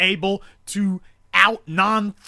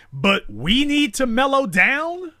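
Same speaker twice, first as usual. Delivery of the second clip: incredulous question; 7 semitones higher than usual. Real, not acted. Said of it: "Are you serious?".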